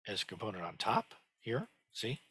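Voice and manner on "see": normal talking voice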